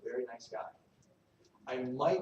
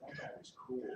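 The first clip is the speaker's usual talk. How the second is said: awed whoa; 3 semitones lower, 11 dB quieter than usual. Retold or imitated. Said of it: "I want them to be like".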